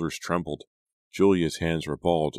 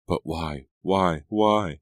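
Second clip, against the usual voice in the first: each why louder, rising to a mild shriek; building shriek; 5 dB louder, much slower than usual. A narrator's reading. Hannah demands why from her sister, building to a shriek. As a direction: let the panic build to a shriek. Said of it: "Hannah shrieked".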